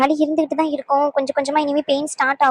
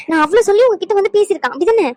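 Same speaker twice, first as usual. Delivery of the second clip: heated accusation; 6 semitones higher, 3 dB louder than usual. Acted. Wife angrily accuses the friend.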